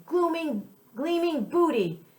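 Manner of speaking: high, gleeful singsong. Acted. Pirate gloating over the chest, high and gleeful. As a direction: croon over the treasure. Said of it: "Petey sang".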